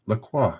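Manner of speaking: La Croix said as "luh-KWAH"; French-style pronunciation. Shown as a demonstration. Host checking how the brand is pronounced, French-style. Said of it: "It's pronounced".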